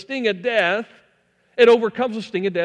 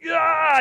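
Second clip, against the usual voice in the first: high, loud mock cry of pain; sudden imitated "Ah!" of hurt; acted yelp of pain; 11 semitones higher, 5 dB louder than usual. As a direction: yelp as if just stung. Acts out dad stung by bee.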